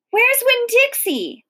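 high, alarmed question; high-pitched storybook shout; narrated shout. A narrator's reading. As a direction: cry it out high in sudden worry. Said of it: "I shouted".

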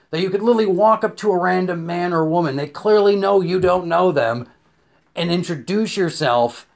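ordinary delivery

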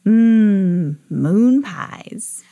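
savoring, drawn-out mmm; hungry longing. A narrator's reading. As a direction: drool over the treat. Hungry boy imagines moon pies.

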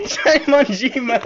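high, slow, through laughter; laughing out loud. Real, not acted. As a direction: burst out laughing while repeating it. Repeats the line, laughing, after the call.

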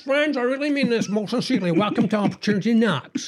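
high, showy game show host voice; Huey Green. Retold or imitated. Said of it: "my Huey Green impression".

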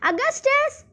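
put-on high-pitched call to a wayward child; a sharp rise, calling someone back. A narrator's reading. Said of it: "called out Miss Gloop".

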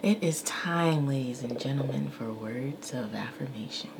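slow whispered announcer voice; soft, slowed mock-host intro; hushed announcer. Real, not acted. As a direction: announce the segment in a breathy whisper. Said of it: "Do I have a voice?".

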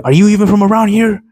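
high, fast sneering cop voice; mocking the cop. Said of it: "And he's like".

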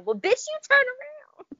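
high, loud sassy comeback; exasperated retort. Retold or imitated. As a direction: yell it back at the screen, fed up. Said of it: "I wanted to be like".

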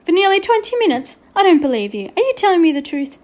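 a teacher who won't be fooled; lightly voiced stern schoolteacher. A narrator's reading. Teacher disbelieves pupil.

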